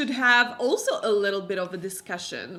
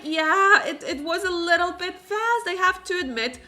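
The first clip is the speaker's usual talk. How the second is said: higher-pitched, lightly voiced reluctant agreement; hesitant Rowan; 6 semitones higher, 4 dB louder than usual. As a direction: admit it in a raised, half-laughing voice. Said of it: "And Rowan is like".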